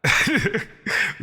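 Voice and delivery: short, high, loud laugh; real laugh. Real, not acted. Creator scoffing at platforms' paid reach, laughing. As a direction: break into a quick laugh mid-rant. Amused at platform's viral payoff.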